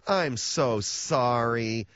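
slow, syrupy fake apology; disingenuous sorry. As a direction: drag it out with a fake smile. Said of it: "that real disingenuous smile".

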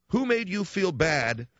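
drawn-out probing question; slow, pointed question. Retold or imitated. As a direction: press the question slowly. Same question repeated slowly, deliberately.

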